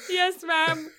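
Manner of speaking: put-on child answers teacher through tears, tearful; tearful yes. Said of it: "I'm already crying at this point".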